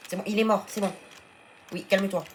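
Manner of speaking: urgent reassurance; agitated. Real, not acted. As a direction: settle down the panicked dino. Calming frantic pet after fight.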